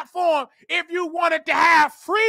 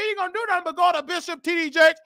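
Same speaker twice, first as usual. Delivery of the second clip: ordinary talk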